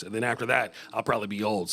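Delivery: ordinary talk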